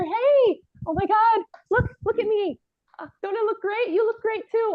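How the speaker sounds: high, bubbly greeting to her friends; excited bride. Acted. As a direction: gush at the bridesmaids.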